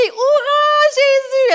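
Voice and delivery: high, loud hurrah; crowd cheer. Acted. Crowd crying out to welcome Jesus, loud jubilant cheer. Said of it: "puis tout le monde crie".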